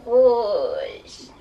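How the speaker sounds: drawn-out, loud witchy word; witch voice. Shown as a demonstration. Teacher shows class a witch saying the colour, witch voice. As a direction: stretch it out like a witch. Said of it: "say it like a witch".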